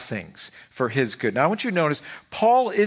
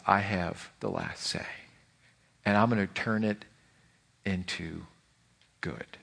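lower, much softer, fading to near silence; hushed voice of God; 9 semitones lower, 7 dB quieter than usual. Pretend. God to those in despair, lower and fading soft. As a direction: let the promise sink in gently.

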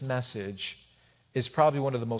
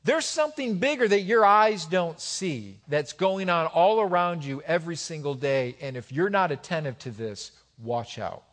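urgent warning; 6 semitones higher, 5 dB louder than usual. Retold or imitated. Paul warning the church about unseen battles, urgent warning. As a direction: warn them hard, then bring it down. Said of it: "Paul is warning them".

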